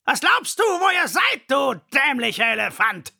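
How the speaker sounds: lion roaring in scorn; furious roar. A narrator's reading. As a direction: roar it down at the foolish elephant. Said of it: "brüllt er".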